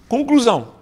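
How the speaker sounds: ordinary talk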